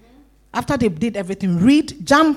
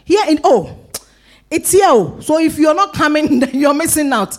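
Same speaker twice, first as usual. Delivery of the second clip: high, raised preacher's exhortation; impassioned preaching; 6 semitones higher, 4 dB louder than usual. Real, not acted. Preacher telling church not to miss out, impassioned exhortation. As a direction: press the congregation with high, urgent insistence.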